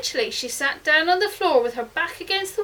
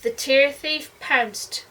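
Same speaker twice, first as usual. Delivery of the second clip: ordinary delivery, nothing marked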